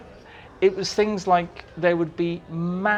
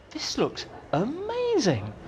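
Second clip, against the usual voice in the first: awed delight; 9 semitones higher, 5 dB quieter, slower than usual. Retold or imitated. On set, awed exclamation.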